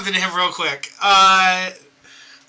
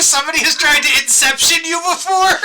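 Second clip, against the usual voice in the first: laughing disbelief, higher; 7 semitones higher than usual. Acted. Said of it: "I'm like".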